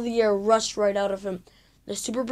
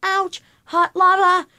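high, loud yelp; boy rolling in the goo, shouting high and loud; 9 semitones higher, 5 dB louder than usual. Acted. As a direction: cry out as if scalded. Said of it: "George's mouth shouted".

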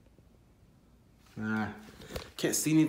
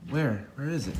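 normal talking voice

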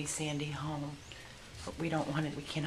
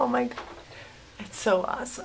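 loud, higher delighted cries; happy exclaiming; 8 semitones higher, 6 dB louder than usual. Real, not acted. Rescuer still thrilled by the good news. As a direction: gush with relief. Joy at negative test.

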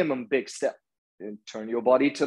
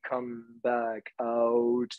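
slow release cue; 4 dB quieter, much slower than usual. Shown as a demonstration.

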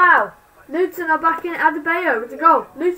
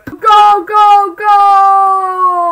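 loud ecstatic goal yell, higher each time; goal shout; 4 semitones higher, 9 dB louder, much slower than usual. Real, not acted. Fan following live scores yells as a goal goes in.

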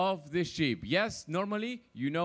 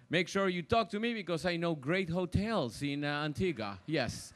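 normal talking voice

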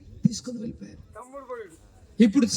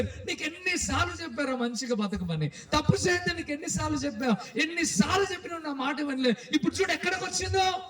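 exasperated high-pitched reproach, rising higher; acted scolding; 7 semitones higher, 5 dB quieter than usual. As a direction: scold a wayward younger brother. Elder brother scolds the wrongdoer. (తమ్ముడిని మందలిస్తున్న అన్నలా)

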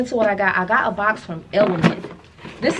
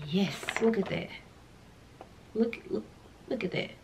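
much softer, almost under her breath; hushed admiring; 13 dB quieter than usual. Real, not acted. Vlogger showing off a skincare product, hushed and admiring. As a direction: murmur it while admiring the product.

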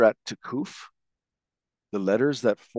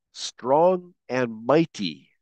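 slow emphasis; much slower than usual. Shown as a demonstration. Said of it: "are the definitions".

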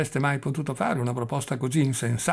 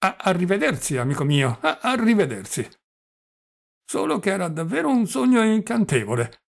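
put-on higher, stammering old man savouring a memory; doddering old prince, dreamy; 7 semitones higher, 5 dB louder than usual. Old prince telling his nephew about a beautiful woman, higher and stammering. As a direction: stammer fondly over the lovely dream.

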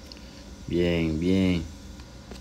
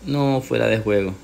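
ordinary talk, nothing marked

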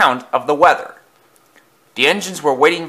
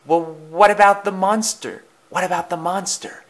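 higher, worried question, hushing on the repeat; Percy; 6 semitones higher than usual. A narrator's reading. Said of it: "whispered Percy".

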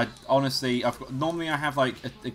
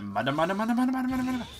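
rising, higher [name] battle chant; [name] impression; 11 semitones higher, much faster than usual. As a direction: go all out like [name]. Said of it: "He goes properly, like, for it".

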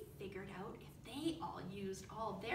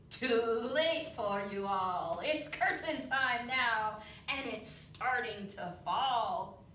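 hissing villain; 10 dB louder, slower than usual. A narrator's reading. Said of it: "The big judge hissed softly".

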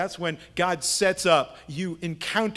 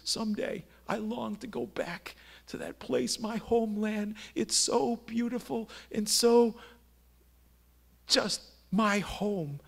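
soft, slightly higher wistful retelling; heartfelt longing; 4 semitones higher, 5 dB quieter than usual. Retold or imitated. Italian worker sharing his heart, softly wistful. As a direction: confide a homesick longing softly, haltingly. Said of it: "he's sharing his heart with me".

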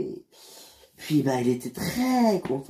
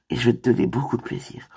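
seductive whisper; 3 semitones lower, faster than usual. Acted. Seduction attempt.

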